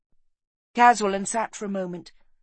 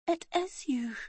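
high, breath-only whisper; soft whispered recognition; 11 semitones higher, 5 dB quieter than usual. A narrator's reading. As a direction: whisper it weakly with dying breath. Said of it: "she whispered".

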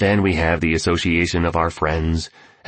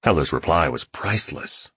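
ordinary delivery, nothing marked

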